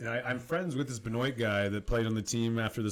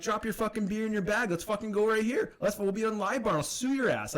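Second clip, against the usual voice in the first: challenging yell; 8 semitones higher than usual. Retold or imitated. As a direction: yell at him to drop the beer and fight. Calling guy out to fight.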